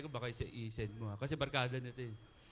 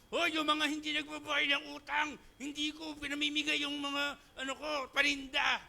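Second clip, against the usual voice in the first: hammed-up angry online rant; mock angry post; 6 semitones higher than usual. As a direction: yell at debtors online in jest. Mock social media callout.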